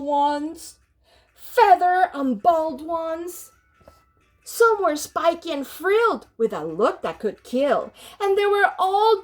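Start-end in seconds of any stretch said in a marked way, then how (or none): none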